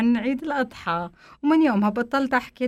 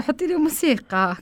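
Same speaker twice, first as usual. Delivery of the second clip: higher, lightly voiced husband; husband demanding; 7 semitones higher than usual. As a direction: toss off a lazy order. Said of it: "قال لي".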